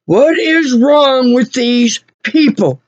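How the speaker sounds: slow, drawn-out, emphatic disbelief; exasperated. Real, not acted. Customer venting about the garage workers, emphatically exasperated. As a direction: stretch every word in exasperation. Exasperated question, slower repeat.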